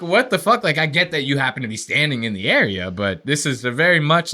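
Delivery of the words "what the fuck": incredulous outburst. Retold or imitated. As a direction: blurt it out in shock, pitched up. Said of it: "I'm like".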